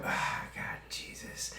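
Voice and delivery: voiceless sigh of being overcome; whispered exclamation. Real, not acted. Recalling the show that made them cry, whispered. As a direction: breathe it out under your breath.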